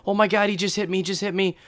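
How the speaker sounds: high, rapid mock cries of a panicked wife; imitated panicked yelling. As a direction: act out her frantic phone call without full screaming. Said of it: "I just hear her screaming. She's yelling".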